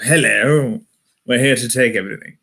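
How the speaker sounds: lightly voiced posh British RP; mock upper-class RP accent. British colonizer announcing himself to the world, posh RP accent. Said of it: "that kind of R P".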